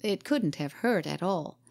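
usual speaking voice

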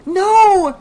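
long, very high, loud shriek. A narrator's reading. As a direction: shriek it out in horror. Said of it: "she shrieked".

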